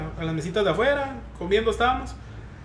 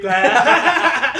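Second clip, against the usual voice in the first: loud laugh; 6 semitones higher, 8 dB louder than usual. Real, not acted. Laughs at happy ending joke.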